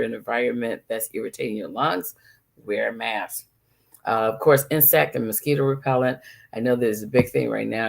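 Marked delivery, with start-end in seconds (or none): none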